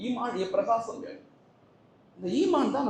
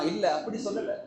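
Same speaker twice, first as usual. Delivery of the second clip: ordinary delivery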